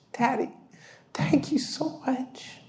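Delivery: high, slow whispered thanks; a sleepy child thanking Daddy; grateful little girl. Retold or imitated. Relieved daughter thanks dad.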